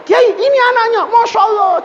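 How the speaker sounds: high-pitched amazed report; excited announcement. Acted. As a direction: call it out, thrilled at the find. Officers return the lost child.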